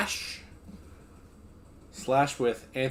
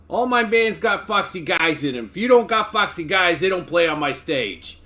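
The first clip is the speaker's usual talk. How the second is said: put-on a light East Coast accent, louder; 4 semitones higher, 6 dB louder than usual. Club owner proclaiming his rule in a light East Coast accent. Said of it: "proclaimed in his East Coast accent".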